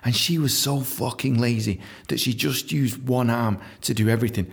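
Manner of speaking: usual speaking voice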